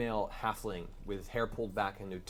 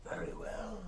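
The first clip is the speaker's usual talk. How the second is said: slow, eerie whisper; creepy whisper; 9 semitones higher, 6 dB quieter, slower than usual. A narrator's reading. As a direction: breathe it slowly like a mind voice. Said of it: "a creepy whispered voice".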